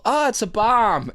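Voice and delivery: high, loud, stretched-out mock alarm; retold yell. Kid pranking second-grade class with bomb scare, retold yell. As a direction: call out a prank warning. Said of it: "to yell".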